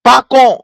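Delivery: Park on said as "pak on" with no r; Park on in Liberian English, "pak on". Shown as a demonstration. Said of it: "we can say it in our Liberian English".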